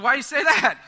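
retold burst of alarm; alarmed reply. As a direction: act out a taken-aback demand. Retelling his alarmed reply to friend.

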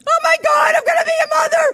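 very high, loud shriek of joy; ecstatic screaming. Retold or imitated. Woman learning she'll be a mother, ecstatic screaming. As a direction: scream it, overcome with the news. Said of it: "I'm screaming".

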